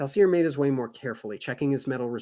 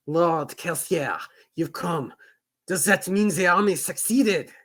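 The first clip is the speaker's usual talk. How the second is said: put-on French accent; 6 semitones higher, 5 dB louder than usual. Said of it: "my French accent".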